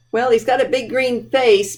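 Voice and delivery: ordinary delivery, nothing marked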